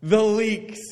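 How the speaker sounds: high, hammed-up longing for food; mock wailing. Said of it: "started wailing and said".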